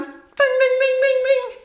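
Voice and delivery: high sung ding ding bell; imitated bell. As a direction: chime it like a lunch bell.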